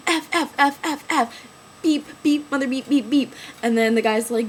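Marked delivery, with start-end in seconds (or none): 0.1-3.3 rapid-fire mock swearing, raised pitch; self-censored cussing. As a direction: spit out bleeped curses in a higher voice. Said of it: "I was, like, cussing".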